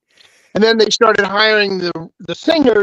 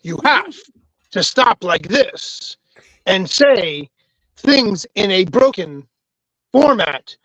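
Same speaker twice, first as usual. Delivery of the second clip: halting delivery with odd pauses; William Shatner. Shown as a demonstration. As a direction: enunciate hard and break phrases mid-sentence. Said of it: "in order to sound like William Shatner".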